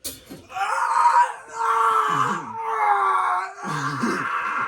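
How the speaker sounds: high, loud wailing yawn; exaggerated yawn cry. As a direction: yawn it out as a shout. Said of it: "sometimes I'll go like".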